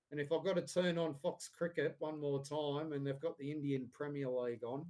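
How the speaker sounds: normal talking voice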